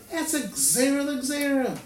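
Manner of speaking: high-pitched retold outcry, not truly screamed; mock scream. Teacher imitating the Rebbe's protest to students, mild mock scream. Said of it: "The Rebbe screams".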